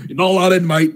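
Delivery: loud mock Aussie doorman; hammed-up Australian turning someone away; Australian bouncer. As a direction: bark it like an outback bouncer. Kangaroo bouncer voiced in bit.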